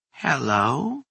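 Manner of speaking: put-on thin, brittle old woman calling out. A narrator's reading. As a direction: call out like a frail old woman. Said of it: "a voice called out".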